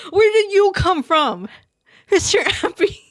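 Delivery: a delighted startled cry at a surprise guest; surprised. Real, not acted.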